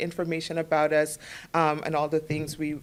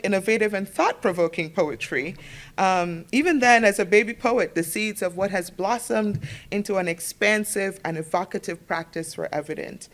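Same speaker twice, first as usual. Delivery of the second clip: ordinary talk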